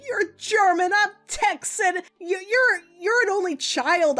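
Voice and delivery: high-pitched, worked-up rant; venting. Retold or imitated. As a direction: pile up the objections, pitch climbing. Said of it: "He's letting me vent it all out".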